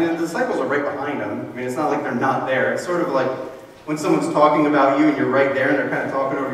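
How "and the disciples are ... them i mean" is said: ordinary talk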